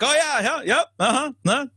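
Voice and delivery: imitating a wrestling legend nodding along without listening; absent-minded agreeing. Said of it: "he's not listening to you".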